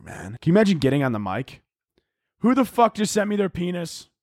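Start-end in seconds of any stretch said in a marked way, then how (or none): 2.4-4.1 high-pitched mock outrage; irate overdramatic pilot. Pilot ranting at passengers over the mic, mock outrage. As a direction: demand answers in a raised, indignant voice.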